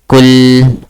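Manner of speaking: COOL as short u with long held l; loud model COOL, short vowel, lingering L; correct pronunciation. Shown as a demonstration. Teacher modelling for student to repeat, correct pronunciation. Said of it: "How do you say?".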